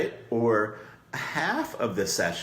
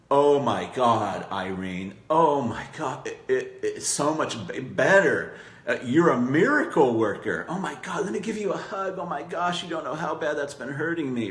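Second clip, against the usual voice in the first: gushing client; 5 semitones higher than usual. Retold or imitated. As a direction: play the overjoyed client gushing thanks. Client gushing after a massage.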